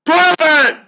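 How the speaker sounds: barked high and loud at the bird; furious shout. Acted. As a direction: fling the word at the raven. Shouting at the raven.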